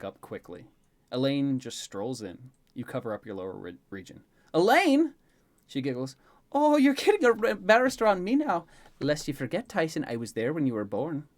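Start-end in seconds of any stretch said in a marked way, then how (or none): 4.5-5.1 loud, very high cry of protest; embarrassed yelp. Acted. Boy caught undressing by Elaine, mortified yell. 6.5-11.3 high, loud teasing start, then easing; amused Elaine. Acted. Elaine to the embarrassed boy, amused and teasing.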